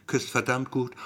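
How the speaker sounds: ordinary delivery, nothing marked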